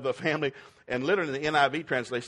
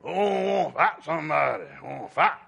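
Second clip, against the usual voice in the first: Goliath; 2 semitones higher, 5 dB louder than usual. Acted. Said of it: "Goliath, ten-foot-two".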